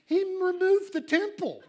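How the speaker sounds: high, loud incredulous cry; preacher's high, ringing exclamation; exclaimed punchline. Real, not acted. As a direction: drive it home at high pitch and full voice.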